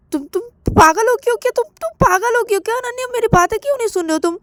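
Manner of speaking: high, rapid-fire scolding; heated, high-pitched protest; exasperated scolding. Acted. Acted quarrel over calling doctor.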